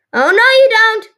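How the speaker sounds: high, loud cry of protest; screaming. A narrator's reading. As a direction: scream it to stop someone.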